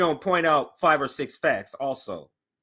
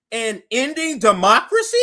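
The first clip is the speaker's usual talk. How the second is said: voice going up in disbelief; 6 semitones higher, 5 dB louder than usual. Pretend. Host riffing on a forced choice, voice rising in mock disbelief. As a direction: pitch climbs to a high, loud DEMOCRACY. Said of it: "You notice when my voice go up?".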